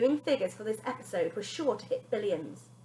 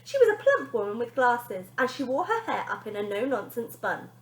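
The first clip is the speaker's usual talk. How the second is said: ordinary delivery, nothing marked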